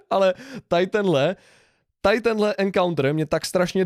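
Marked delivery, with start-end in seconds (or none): none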